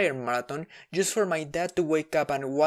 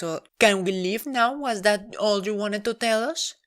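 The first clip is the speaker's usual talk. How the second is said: higher, sweet oblivious mom voice; imitating mom; 5 semitones higher than usual. Mom guessing wrongly, higher and oblivious. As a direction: play the clueless mom guessing. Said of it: "And she goes".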